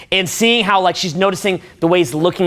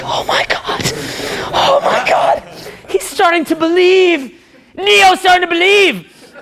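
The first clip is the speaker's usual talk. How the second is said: awestruck viewer; 11 semitones higher, slower than usual. Retold or imitated. Viewer watching Neo awaken, shrieking with excitement. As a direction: squeal it like you can't believe it. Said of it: "And you're like".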